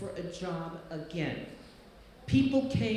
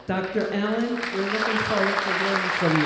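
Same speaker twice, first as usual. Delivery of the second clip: loud, fast stage introduction; announcing loudly; 8 dB louder, faster than usual. Real, not acted. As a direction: call her up with a big welcome.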